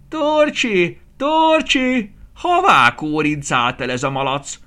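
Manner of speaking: put-on high, loud calling of an old woman. Aranka néni calling for her missing piglet, high and loud. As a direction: call across the yard for the pig.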